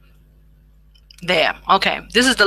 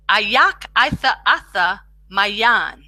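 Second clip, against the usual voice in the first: slow demo; slower than usual. Shown as a demonstration. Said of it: "You can speak it out".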